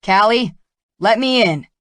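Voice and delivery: shouting at her from outside the glass door; angry shout. Acted. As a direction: call her name loud and demanding. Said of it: "he frowned and shouted".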